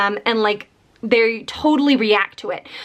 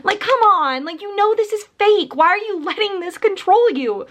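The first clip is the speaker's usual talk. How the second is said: higher, scolding the character in disbelief; exasperated scolding; 8 semitones higher than usual. Retold or imitated. Reviewer scolding a book heroine, exasperated and higher. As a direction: tell off the heroine like she should know better. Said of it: "I can't help but just be like".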